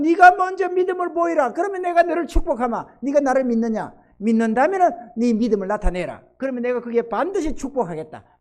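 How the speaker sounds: God's command, loud and high; forceful preaching. Acted. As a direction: proclaim it as God's own demand.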